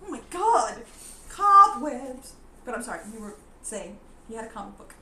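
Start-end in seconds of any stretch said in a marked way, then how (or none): none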